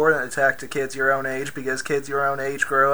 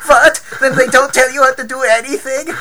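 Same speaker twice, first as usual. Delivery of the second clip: put-on shocked high German accent; 12 semitones higher, 12 dB louder than usual. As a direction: gasp it out, loud and high, German. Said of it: "in his German accent".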